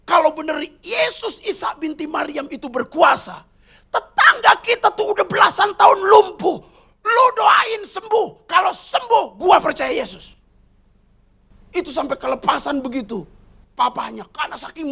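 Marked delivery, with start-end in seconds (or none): 0.1-10.4 high, loud furious challenge; the father shouts a dare in rage; angry father. Retold or imitated. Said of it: "saking marahnya papanya ngomong begini".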